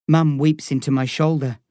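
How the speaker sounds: ordinary delivery, nothing marked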